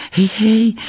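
very high little squeak; squeal. A narrator's reading. Little animal joining the calls to castaways, high squeal. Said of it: "pisnął zwierzaczek".

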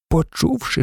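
ordinary delivery

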